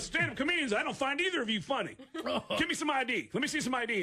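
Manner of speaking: high, insistent cop putdown; scornful officer. Retold or imitated. Officer to two comedians, scornful demand. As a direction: imitate an unamused officer giving orders.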